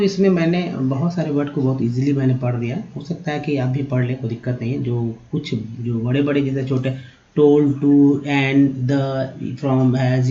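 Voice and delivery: ordinary talk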